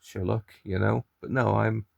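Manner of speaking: normal talking voice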